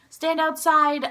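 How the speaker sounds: lightly voiced loudspeaker announcement; principal on loudspeaker. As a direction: imitate a principal calling out orders. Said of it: "the principal on the loudspeaker".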